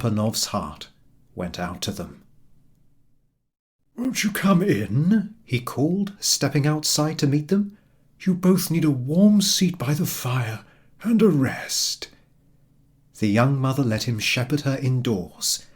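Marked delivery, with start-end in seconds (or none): 3.9-5.3 put-on high, slow old cobbler calling out; a lightly voiced old man calling; calling out kindly. A narrator's reading. Papa Panov calls to the mother. 8.2-12.1 higher, lightly voiced old cobbler; a hint of a kindly old man; old man inviting. A narrator's reading. Papa Panov invites her in.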